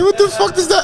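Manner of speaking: very high bewildered reaction; mock baffled. Reacting to celebrity news, high baffled voice. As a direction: squeak out the confusion. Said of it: "I'm like".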